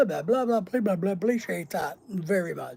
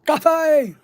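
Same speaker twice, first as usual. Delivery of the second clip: louder, higher, perked-up call of coffee; eager coffee call; 8 semitones higher, 6 dB louder, slower than usual. Retold or imitated. Answering a chatter's coffee mention with a perked-up, louder, higher call. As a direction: perk up at the word like it's a summons. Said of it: "Did someone say".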